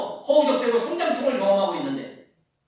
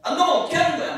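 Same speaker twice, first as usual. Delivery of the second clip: mock judgmental; 8 semitones higher, faster than usual. Mocking judgmental believers.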